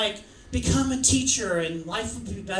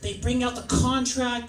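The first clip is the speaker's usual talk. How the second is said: normal talking voice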